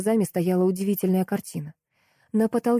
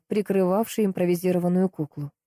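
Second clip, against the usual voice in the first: ordinary delivery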